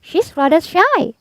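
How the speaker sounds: high, sly goose voice. A narrator's reading. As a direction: fib sweetly about the snurd. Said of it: "the goose replied".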